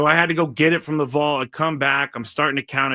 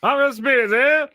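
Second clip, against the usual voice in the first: higher, louder echo of the peanut call; stadium vendor hawking; 10 semitones higher, 5 dB louder than usual. Pretend. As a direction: repeat the vendor call, bigger.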